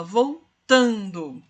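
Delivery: voltando, slow, with TAN held long; stress on the penult. Shown as a demonstration. Teacher illustrates a paroxítona, voltando with drawn-out TAN. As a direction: split voltando and lengthen its TAN. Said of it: "sílaba tônica a penúltima sílaba".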